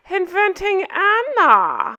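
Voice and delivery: put-on slow, stretched Russian-German accent on the title; mock Anna accent. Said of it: "I am distracted by her accent".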